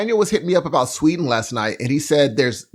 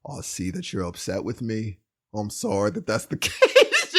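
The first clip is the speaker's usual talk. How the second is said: low, calm mock-British apology; mock-placid, quietly reasoning; chill boyfriend; 7 semitones lower, 5 dB quieter than usual. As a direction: play the unbothered boyfriend, low and soft. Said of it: "they're both so chill".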